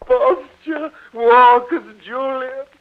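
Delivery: high, slow theatrical command; dramatic exhortation; impassioned urging. Acted. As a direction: implore with a rising, insistent voice. Father urges daughter to walk properly.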